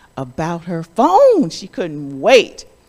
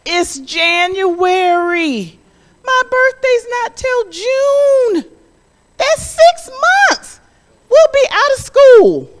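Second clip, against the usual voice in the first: put-on high, loud whiny child voice; protesting child; 7 dB louder than usual. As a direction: complain like a kid who can't wait. Child protesting mother's answer.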